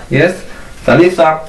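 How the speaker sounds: normal talking voice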